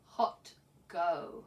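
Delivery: ordinary talk, nothing marked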